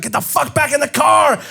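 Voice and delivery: high-pitched imitation of a screaming girlfriend; retold scream. Girlfriend ordering him back into the car, imitated high-pitched scream. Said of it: "She was screaming at me".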